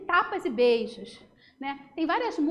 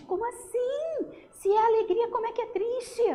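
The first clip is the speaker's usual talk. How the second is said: mock puzzlement; 6 semitones higher than usual. Teacher feigns puzzlement.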